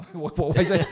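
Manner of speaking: laughing mock paramedic. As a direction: mimic the puzzled paramedics through laughter.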